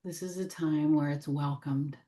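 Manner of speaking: measured meditation voice, long silences between phrases; gently invite, leaving room after each phrase; guided meditation. Real, not acted. Guide welcoming what needs healing, gentle meditation voice.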